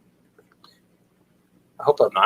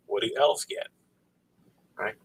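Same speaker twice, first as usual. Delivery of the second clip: normal talking voice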